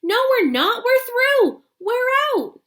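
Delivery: very high, loud exultant shout; loud high victory whoop; triumphant cry. Acted. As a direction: shout the breakthrough with joy. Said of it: "And Mister Wonka said".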